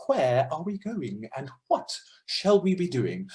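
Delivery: slow, exaggerated enunciation with swooping pitch; over-articulate each word, swooping up and down; Jenny Cruz Williams. Choir teacher imitating a type of speaker, slow exaggerated diction. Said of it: "the Jenny Cruz Williams of the world".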